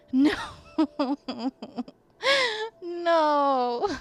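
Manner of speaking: loud, near-crying no; whimpering no. Real, not acted. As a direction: plead in fright. Crying out after scare.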